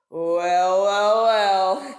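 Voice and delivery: a shark licking his lips aloud; gloating shark. Acted. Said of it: "thought the shark".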